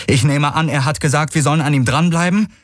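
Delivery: high-pitched called question; calling out. A narrator's reading. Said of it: "rief Kirai".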